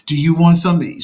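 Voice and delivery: loud, high-pitched cooing question; shifted baby-talk voice. Shown as a demonstration. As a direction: pitch it way up as if fussing over a crying baby. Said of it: "shifting thing with her voice".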